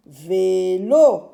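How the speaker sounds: "Vélo" split into "Vé", then loud "lo"; stretched "Vé", punched "lo"; syllable by syllable. Shown as a demonstration. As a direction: test "Vé... lo" in two beats. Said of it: "je mets ici la syllabe lo".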